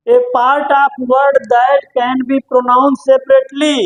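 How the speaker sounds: reads the English clue very slowly; slow dictation. Shown as a demonstration. Teacher reading the quiz clue to students, very slowly. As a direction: spell the clue out word by word for the class.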